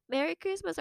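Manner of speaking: a hint of a high toy voice; talking toy. Imitating the talking pillow's greeting, lightly high. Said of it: "It was like".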